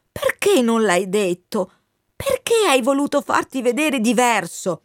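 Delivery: impassioned Wind figure. Acted. Wind confronts the colonel about hiding his age, impassioned. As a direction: urge a confession out of an old friend, pitch lifted.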